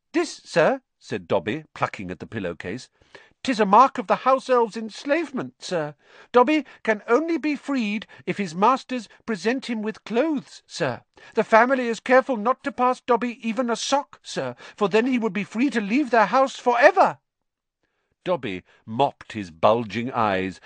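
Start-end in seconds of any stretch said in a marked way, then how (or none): 0.1-0.8 high, squeaky elf voice, weakly cheerful; squeaky elf, meek and matter-of-fact; resigned house elf. Acted. Elf voice held. 3.4-17.2 high, squeaky elf voice, explaining; squeaky elf, humble and earnest; earnest house elf. Acted. Elf voice held.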